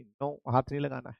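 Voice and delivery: soft squeaky little fish voice pleading no; fish saying no. Pretend. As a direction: play a tiny fish refusing, softly. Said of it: "वो क्या बोलती है?". (मछली की धीमी, नखरीली ना)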